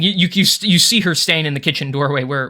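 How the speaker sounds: ordinary delivery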